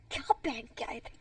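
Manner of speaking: normal talking voice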